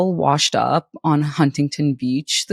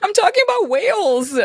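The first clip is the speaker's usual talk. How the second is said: giggly defence. Real, not acted. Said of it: "What are you saying right now".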